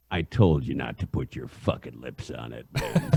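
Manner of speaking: deep low announcer growl; booming voice of God. Retold or imitated. Imitating Ernie scolding the teen over the cigarette, deep rumble. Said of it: "Like the voice of God".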